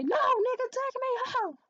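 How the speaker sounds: very high, loud retold shout; exasperated re-enacted holler; acted yelled refusal. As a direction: cut off the pleading with a drawn-out yell. Retelling her yelled refusal.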